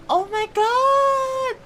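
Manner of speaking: high, very slow tearful wail; crying out. Real, not acted. Streamer overcome by the sad quest, high and wailing. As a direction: let the cry break through the words. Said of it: "I'm crying again".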